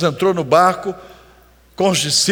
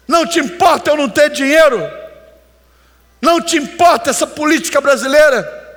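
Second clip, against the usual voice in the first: higher-pitched, exasperated pleading with shouted bursts; desperate plea; 8 semitones higher than usual. Retold or imitated. Disciples shaking Jesus, begging him to care, in a higher exasperated plea. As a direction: cry out higher, like disciples shaking their master awake. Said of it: "eles sacodem Jesus e diz".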